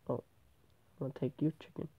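normal talking voice

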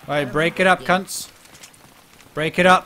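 loud call to break up the crowd. Real, not acted. Breaking up a crowd of players, loud call-out. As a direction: shout it across the street to split them up.

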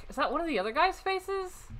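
usual speaking voice

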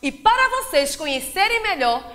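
ordinary delivery

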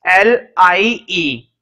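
slow letter-by-letter spelling. Real, not acted. Teacher spelling a word for note-takers, slow spelling. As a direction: spell it out, each letter separate.

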